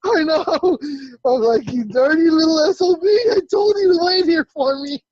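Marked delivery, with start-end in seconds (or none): 1.7-5.0 high, loud retold outrage; indignant scolding. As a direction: tear into your little brother, re-enacting it. Scolding brother who abandoned him.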